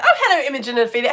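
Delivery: ordinary talk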